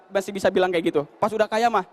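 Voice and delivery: usual speaking voice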